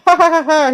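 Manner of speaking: high, loud "ha ha" mock laugh; imitated laughter. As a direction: imitate someone laughing in the back. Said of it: "someone's laughing in the back, saying".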